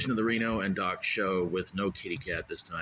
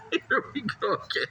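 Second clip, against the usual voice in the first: said high through laughter; giggling here we go again; laughing. Real, not acted. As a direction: laugh it out in disbelief. Laughing reaction.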